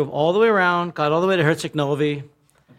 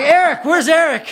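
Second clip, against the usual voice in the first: high, louder, laughing for Eric; laughing search call; 11 semitones higher, 6 dB louder than usual. Retold or imitated. Looking for friend on train.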